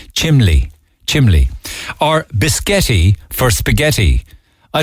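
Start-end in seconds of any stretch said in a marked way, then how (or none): 0.1-1.5 chimley with an L in place of the N, said fondly; fond Cork chimley. Shown as a demonstration. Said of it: "It's Cork, isn't it?".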